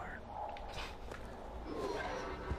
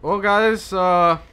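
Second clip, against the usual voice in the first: loud, raised sign-off voice; mock show host; 3 semitones higher, 6 dB louder than usual. Host closing parenting tips segment, mock sign-off. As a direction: wrap up like a show presenter.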